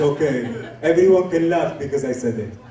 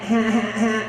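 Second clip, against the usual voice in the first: higher, mock stoner giggle; giggly high; 6 semitones higher than usual. Shown as a demonstration. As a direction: demonstrate a light, giggly kind of high. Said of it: "I'm not talking like that".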